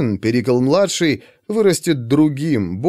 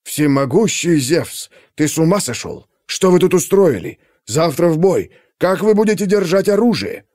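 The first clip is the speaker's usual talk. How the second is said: angry father; 4 semitones higher than usual. A narrator's reading. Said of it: "Перикл не выдержал".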